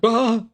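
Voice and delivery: a high, startled mock yelp; imitating a cartoon dad's startled yelp; Hank Hill freak-out. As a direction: yelp like a panicking cartoon dad. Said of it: "I was going full Hank Hill".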